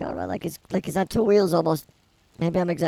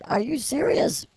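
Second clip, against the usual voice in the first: incredulous; 7 semitones higher than usual. Retold or imitated. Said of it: "I'm like".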